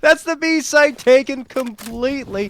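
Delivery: loud, high, stretched-out call; full-force esports commentary; excited play-by-play. Real, not acted. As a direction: shout the big moment to the crowd. Live play-by-play.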